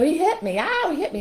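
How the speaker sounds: high-pitched imitation of a hurt daughter; tattling child. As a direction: wail it up high to mom. Daughter tattling after being hit.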